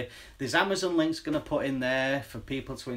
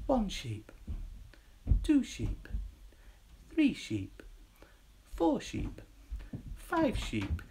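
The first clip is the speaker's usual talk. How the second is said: soft sleepy sheep count; drowsy counting sheep; 4 semitones higher, 5 dB quieter, much slower than usual. Pretend. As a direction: count sheep to lull them. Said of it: "I'll give you the countdown for sheep".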